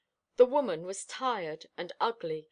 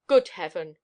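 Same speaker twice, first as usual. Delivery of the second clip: plain reading voice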